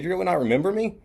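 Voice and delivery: high-pitched incredulous question; feigned disbelief. Retold or imitated. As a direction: play wounded astonishment at being forgotten. Prankster feigns disbelief at being forgotten.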